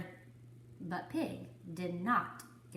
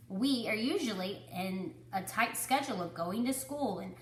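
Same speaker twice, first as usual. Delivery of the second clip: plain reading voice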